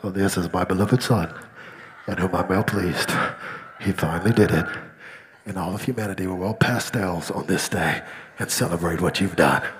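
very deep, booming-low heavenly voice; God the Father from heaven. Acted. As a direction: drop far down and proclaim as God. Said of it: "then I'll be like".

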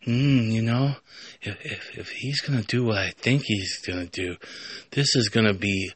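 soft, musing inner voice; thinking to herself. Acted. Cat girl wonders what comes next, quiet musing. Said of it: "she's thinking".

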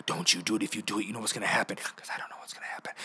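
low, soft mock threat; near-whispered stare-down warning; hushed warning. Adult staring down kid with water gun, low hushed warning.

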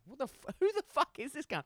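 high-pitched exasperated outburst; indignant, pitch shooting up in disbelief; worked up. Retold or imitated. Worker fuming to himself about a colleague, worked up. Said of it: "I'm getting worked up".

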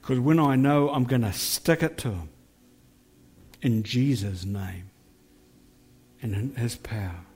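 earnest spoken prayer to the Lord. Acted.

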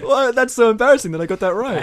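high, loud, laughing admission; laughing embarrassment. Real, not acted. Owning up to co-hosts about knowing the film, laughing embarrassment. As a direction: blurt it out through embarrassed laughter.

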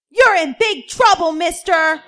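put-on a bossy child wagging her finger; high, loud, bossy little girl. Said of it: "came a voice from the kitchen".

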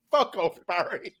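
slow, dismissive brush-off; a hint of gruff Aquaman telling Barry off; lightly voiced Aquaman. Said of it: "Jason Momoa's Aquaman just goes".

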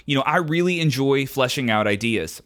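usual speaking voice

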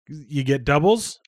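ordinary talk, nothing marked

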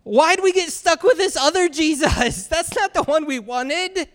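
high, loud mock whine of protest; frustrated complaint. Crowd complaining about getting the other Jesus, loudly frustrated.

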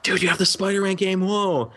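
high, excited gushing; mock excited friend. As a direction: gush like an envious kid. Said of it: "who were like".

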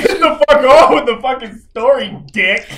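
loud, high, laughing shout; laughing yell. Real, not acted. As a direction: yell through laughter to hurry. Friend yells to move on.

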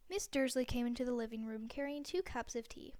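ordinary delivery

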